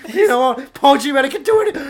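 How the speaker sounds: high, loud, laughing put-on voice; silly high voice. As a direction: squeal it out like a cartoon. Voicing Paul Giamatti, high and laughing.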